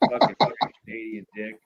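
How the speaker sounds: ordinary delivery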